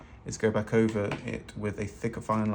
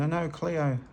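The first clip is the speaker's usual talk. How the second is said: put-on higher, drawn-out Australian accent; 6 semitones higher, slower than usual. Artist quotes her catchphrase to an Australian, slow mock-Australian accent. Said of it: "where this Australian accent comes from".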